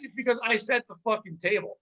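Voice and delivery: ordinary talk, nothing marked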